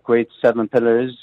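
ordinary delivery

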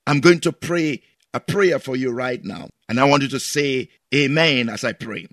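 normal talking voice

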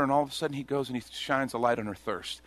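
usual speaking voice

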